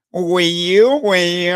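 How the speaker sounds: high, loud mock Ebonyi accent, hammed-up. Mocking a politician who put on an Ebonyi accent for Ebonyi people, loud high mock Ebonyi accent. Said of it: "He even added accent".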